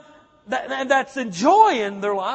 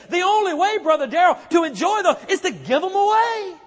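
loud, high declaration; fervent preacher's cry; preaching shout; 7 semitones higher, 5 dB louder than usual. Real, not acted. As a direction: shout the point home to the church.

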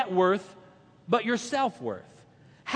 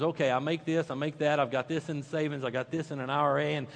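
mock money tally; 8 semitones lower, 8 dB quieter, faster than usual. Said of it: "You're already crunching the numbers".

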